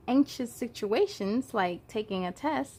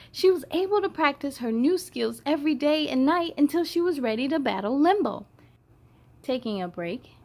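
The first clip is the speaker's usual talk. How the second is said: put-on bright read-aloud for children; storybook reading; 3 semitones higher than usual. A narrator's reading. Storyteller reading to young viewers, bright read-aloud. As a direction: read it to the kids with lift. Reads picture book aloud.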